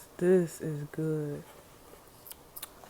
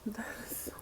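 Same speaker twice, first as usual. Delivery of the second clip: breathy whispered relish; hushed food bliss; 4 semitones higher, 16 dB quieter, faster than usual. Real, not acted. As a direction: whisper "That is so good" as if overwhelmed by taste. Savouring spicy food.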